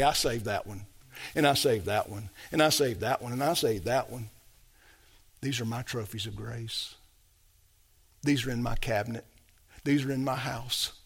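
low, softened, unhurried voice of God; God's tender pride. Acted. God points out his trophies of grace, low and tender. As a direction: speak as God quietly treasuring his own.

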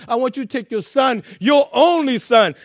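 God's voice, pressing the words "your only son"; emphasis on son. Acted. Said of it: "God puts emphasis on him".

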